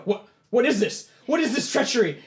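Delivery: high, loud outraged questions; alarmed shouting. Acted. Knights seized by magic threads, alarmed shouting.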